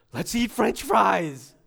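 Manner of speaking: slow, emphatic, suddenly full-voiced; acted rousing response. Listener stirred by the pastor's words, emphatic. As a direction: jump from hush to stirred-up declaration. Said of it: "And you'd be like".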